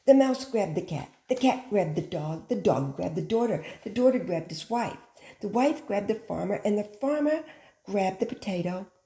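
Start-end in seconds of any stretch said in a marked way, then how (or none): none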